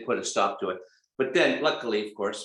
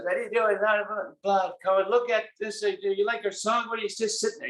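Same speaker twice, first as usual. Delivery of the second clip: high, mocking rant at the crowd; hammed-up rock star heckling the crowd; mock cussing out; 9 semitones higher than usual. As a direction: taunt the audience in a pitched-up voice. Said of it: "He's just cussing them out".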